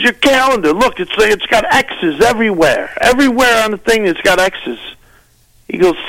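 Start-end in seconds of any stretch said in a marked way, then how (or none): 0.0-4.8 high, excited mock Seinfeld pitch; retelling Jerry showing his X'd calendar, pitched high; imitating Seinfeld. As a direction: show off the calendar in a raised voice. Seinfeld voiced in a retold story.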